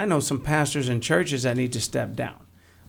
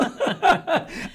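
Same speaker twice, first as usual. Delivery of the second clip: high, bursting real laugh; burst of laughter. Real, not acted. Guest cracking up at his own traffic-stop joke, laughing.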